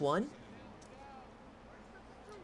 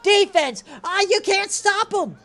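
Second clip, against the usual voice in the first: higher and louder, fired up about the play; excited call; 10 semitones higher, 7 dB louder than usual. Real, not acted. Streamer calling his video game football match, excited. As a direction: call it like the defense just got beat.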